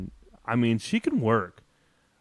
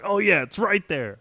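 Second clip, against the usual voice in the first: sarcastic mock announcer; 9 semitones higher, 4 dB louder than usual. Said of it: "they're like".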